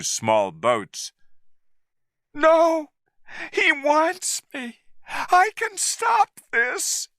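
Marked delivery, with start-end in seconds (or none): 2.3-7.1 very high, slow urgent shout. A narrator's reading. Woman shouting she can stop the ape, very high. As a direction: cry it out to be let go. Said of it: "Anne shouted".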